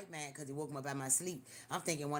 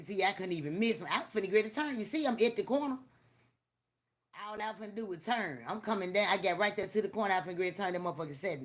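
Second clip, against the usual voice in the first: high and loud, building excitement; loud, eager storytelling; excited retelling; 5 semitones higher, 8 dB louder, faster than usual. Real, not acted. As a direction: tell it all worked up.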